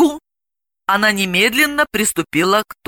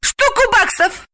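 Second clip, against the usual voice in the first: very high, eager blurt. A narrator's reading. Galina names her price without thinking, high blurt. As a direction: shoot the answer out high and stretched. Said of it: "не раздумывая, выпалила Галина".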